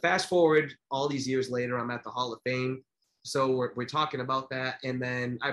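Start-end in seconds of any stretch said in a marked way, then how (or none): none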